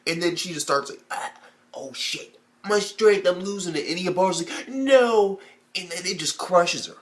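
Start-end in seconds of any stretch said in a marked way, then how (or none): 1.1-3.9 raised pitch on the failing effort; mock straining panic. Trapped sister losing her grip on the rock, mock panic. 4.7-5.4 high, loud drawn-out "no"; mock anguished cry. Ibarra watching the rock crush her, mock anguished cry.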